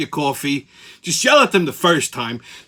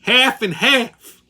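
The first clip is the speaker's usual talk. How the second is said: "Half and half" drawn out slowly, higher; over-enunciated "Half and half" after pulling the mask down; slow, deliberate; 7 semitones higher, 3 dB louder, much slower than usual. Retold or imitated. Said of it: "I pulled the mask down and say it".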